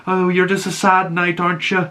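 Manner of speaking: high-pitched teasing imitation of a woman; mocking woman. Woman calls Dunk a sad knight, mocking. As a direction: put on a high voice to taunt him. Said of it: "called Dunk a sad knight".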